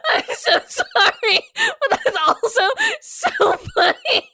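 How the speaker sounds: high, squeaky apology through real laughter; barely talking through tearful laughter; laughing to tears. Real, not acted. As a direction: apologize while helplessly cracking up. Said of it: "I have tears coming out of my eyes".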